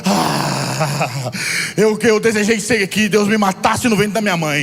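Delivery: preaching at full force. Acted. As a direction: preach it with fire to the congregation. Preaching builds to shouting.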